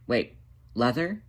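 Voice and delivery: usual speaking voice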